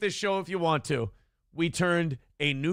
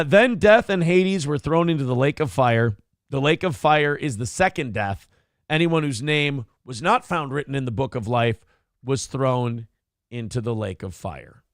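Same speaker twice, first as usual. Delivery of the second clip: dramatic scripture reading; 2 semitones lower, 3 dB louder than usual. Acted. Reading Revelations aloud dramatically.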